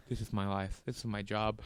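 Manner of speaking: low, weary, grumbling cop; fed-up cop. Retold or imitated. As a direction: sound bored with your job. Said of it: "this cop just looks like he's pissed off".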